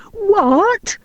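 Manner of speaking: sudden high yelp of shock; yelled. A narrator's reading. Robin hearing Maria may marry, yelling. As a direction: jump up an octave in outrage. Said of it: "yelled Robin".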